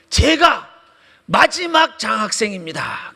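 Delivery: high, loud, drawn-out proclamation; imitated shouted declaration. Principal runs up to reveal himself to the lecturer, imitated shouting. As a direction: cry it out slowly, word by word. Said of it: "뛰쳐나오면서 소리를 지르는 거예요".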